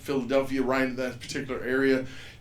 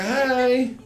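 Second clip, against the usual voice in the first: higher casual hey; kid's hey; 11 semitones higher, 6 dB louder than usual. Retold or imitated. Kid popping out of the bushes, mock child voice. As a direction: pop out with a chirpy hey. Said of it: "he's like".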